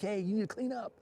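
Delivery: lightly voiced mom, pitched high; high-pitched imitation of a nagging mother; scolding mom. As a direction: nag a kid about a messy room. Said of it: "remember your mom came in your room".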